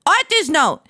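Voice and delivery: high, forceful mock reading; hammed-up author's note. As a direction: read the rant out with exaggerated emphasis.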